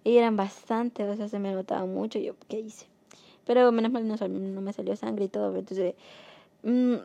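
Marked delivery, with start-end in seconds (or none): 2.5-2.9 hushed retold self-questioning; dismayed whisper. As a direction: whisper it to yourself. Retold dismayed thought to herself.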